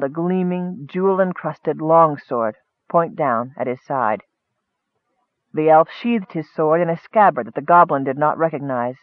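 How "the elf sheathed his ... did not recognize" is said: usual speaking voice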